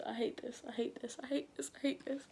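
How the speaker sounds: anxious repeated mantra, softly; scared repeating. Real, not acted. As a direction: keep murmuring it to calm yourself.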